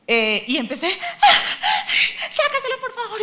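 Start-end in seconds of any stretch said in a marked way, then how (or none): 1.2-3.2 high frantic mock shout, half laughing; panicked shouting. Mother panicking at the dentist, high laughing shout. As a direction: shriek it like a panicked mom. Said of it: "Yo empecé a gritar".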